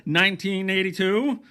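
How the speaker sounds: high, loud, booming ring announcer; boxing announcer. Pretend. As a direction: read the news like a fight intro. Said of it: "I started talking like a boxing, uh, announcer".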